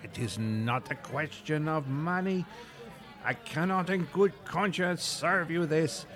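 dramatic French refusal; French waiter. Acted. Pettiford refuses dessert.

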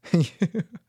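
incredulous little laugh; real laugh. Real, not acted. Podcaster reacting to a senseless killing, disbelieving laugh. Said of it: "I'm laughing 'cause it's ridiculous".

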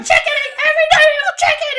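very high, fast shouted rant; hammed-up screechy grandpa tirade; imitating grandpa losing it. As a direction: rattle it off in an outraged yell. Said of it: "He just, like, loses it".

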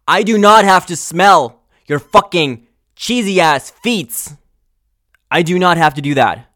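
loud, high, furious rant; raging at the man's cheesy feet; disgusted shout. Real, not acted. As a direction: yell the disgust at him. Ranting about smelly feet.

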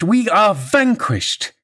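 very slow, high, theatrical lament; drawn-out mock despair. A narrator's reading. Wife taunting her cowed husband, slow mock despair. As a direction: stretch out every word in mock defeat. Mocking repeat, slower and drawn out.

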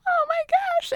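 very high, excited "Oh my gosh"; thrilled squeal. Retold or imitated. Elizabeth reacting to Darcy's second proposal, high squeal. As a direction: squeal with delight.

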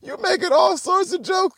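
high, squealed mock outrage; incredulous squeal. Son mocks dad's jokes in high-pitched disbelief. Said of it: "I'm like".

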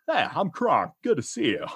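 genial cartoon henchman voice; hearty henchman greeting; Patrick Warburton as Kronk. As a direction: play an amiable, dim sidekick. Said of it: "Patrick Warburton, definitely".

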